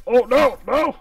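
high, loud no-no-no; panicked yelp. Real, not acted. Near death in game.

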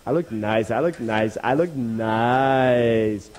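drawn-out self-admiring croon. Acted. Dog admiring his reflection in the water, self-admiring. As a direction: savour each repeat, stretching the last.

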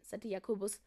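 ordinary delivery, nothing marked

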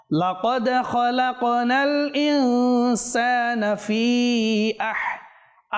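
slow, drawn-out melodic tajweed chant; Quran recitation. Shown as a demonstration. Teacher reciting a verse for viewers to follow, slow melodic chant. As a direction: chant each syllable long and measured.

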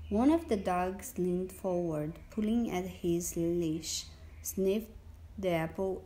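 ordinary delivery

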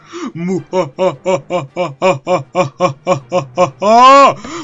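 long booming villain cackle; big gloating laugh, held long; evil laugh. Acted. Villain gloats over evil doings.

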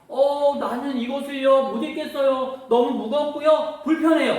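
put-on a touch of childish protest; complaining young David. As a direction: complain lightly about a heavy load. David complains about ill-fitting armour.

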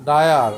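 ডায়ার loud with clear আয়া; model pronunciation. Shown as a demonstration. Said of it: "আয়া উচ্চারণ হচ্ছে". (জোরে ডায়ার উচ্চারণ)

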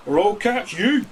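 a fisherman shooing off a fish; gruff fisherman warning. A narrator's reading. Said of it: "said the fisherman".

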